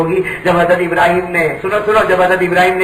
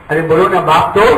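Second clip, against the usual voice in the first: ordinary talk, nothing marked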